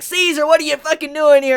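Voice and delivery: hammed-up shocked shout at Caesar; Luffy calling out Caesar in a mock yell. Retelling Luffy spotting Caesar.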